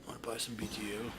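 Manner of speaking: fast, soft shady dealer pitch; quick hushed sales whisper. Pretend. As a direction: hustle it quietly like a street dealer.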